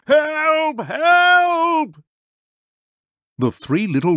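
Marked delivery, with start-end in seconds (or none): 0.1-2.0 high, wailing cry of panic; shouting for help. Acted. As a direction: villagers fleeing a monster, wailing in fright.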